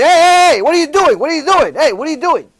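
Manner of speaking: high, loud alarmed calling out; a kid yelling at his mother at the door, high and loud; child's alarmed shout. Retold or imitated. Little Reuben calling to his mother, loud alarmed yell.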